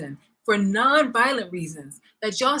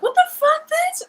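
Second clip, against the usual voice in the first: outraged high yelp; 6 dB louder than usual. Real, not acted. Outraged reaction to quoted racists.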